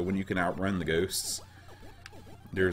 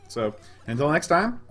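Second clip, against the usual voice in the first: cheerful sign-off; 9 semitones higher, 8 dB louder than usual. Real, not acted.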